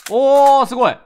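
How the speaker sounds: high, loud delighted exclamation; real excitement at the game; excited. Real, not acted. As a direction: burst out in genuine amazement. Reacting to the lottery shaking.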